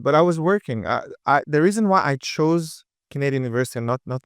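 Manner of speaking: ordinary delivery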